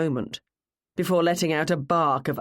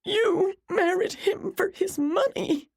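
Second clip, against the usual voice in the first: very high, slow, tearful wail; crying, high-pitched reproach; sobbed accusation; slower than usual. A narrator's reading. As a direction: sob the words out in a high voice. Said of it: "Jennifer sobbed".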